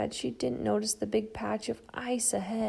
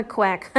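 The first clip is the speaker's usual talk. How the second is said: quack; 4 dB louder than usual. Acted. Duck quacks.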